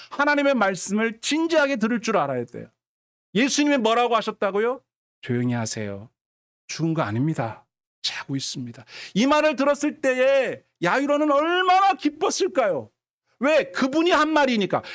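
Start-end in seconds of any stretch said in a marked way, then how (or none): 5.2-8.8 low, soft, composed reassurance; calm authority. Acted. Jesus telling mourners she is asleep, calm. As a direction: settle them gently and surely.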